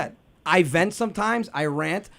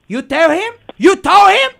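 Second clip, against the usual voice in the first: yelling, higher and louder; angry father; 8 semitones higher, 8 dB louder than usual. Retold or imitated. Father flipping out on his son, a rising yell. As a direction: blow up at the son. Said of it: "He goes".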